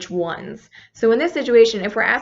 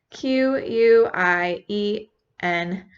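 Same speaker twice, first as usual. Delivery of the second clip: "Q U I E N" spelled out letter by letter, slowly; teacher spells the word out for the class; much slower than usual. Shown as a demonstration. Teacher spells "quién" slowly. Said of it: "quién".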